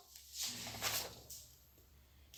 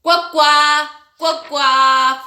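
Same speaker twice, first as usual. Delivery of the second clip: cuac imitado con voz de pato; pato haciendo cuac; 3 semitones higher, 6 dB louder, much slower than usual. Shown as a demonstration. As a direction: imita al pato, fuerte y nasal no, solo graznido. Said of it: "El pato hace". (graznido de pato imitado)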